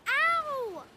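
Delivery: sharp, loud yelp of pain; pained cry. Acted. Boy hurting his toe on a shell, sharp yelp.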